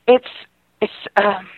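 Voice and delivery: ordinary delivery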